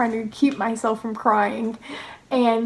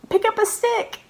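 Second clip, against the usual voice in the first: high-pitched, incredulous at yourself; exasperated self-reproach; 11 semitones higher than usual. Real, not acted. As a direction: point out the obvious, pitched way up. Scolding herself for stupidity.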